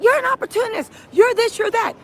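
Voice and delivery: high-pitched imitated yell, little added volume; mock screaming. Retelling him screaming at Jane, mock scream. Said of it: "He then was screaming at her".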